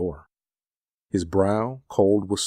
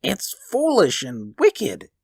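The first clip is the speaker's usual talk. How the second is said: high, slow, uneasy protest; faltering; 13 semitones higher, slower than usual. A narrator's reading. Husband resisting his wife's order, faltering. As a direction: object slowly, losing nerve. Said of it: "he faltered".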